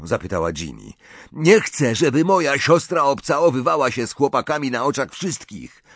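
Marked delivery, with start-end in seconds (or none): 1.3-5.8 raised, higher voice of protest; indignant brother. A narrator's reading. Ron objects to his sister kissing, indignant. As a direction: object hotly to your sister's behaviour.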